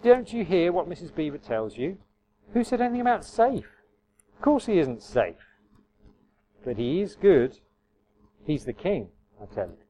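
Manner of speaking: lightly voiced, emphatic beaver. A narrator's reading. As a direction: Mr Beaver setting the children straight. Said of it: "said Mister Beaver".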